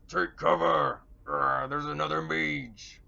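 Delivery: loud growled orc battle cry; orc shout. Acted. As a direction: shout a warning as a rough orc, drawn out. Voices alarmed orcs shouting across chasm.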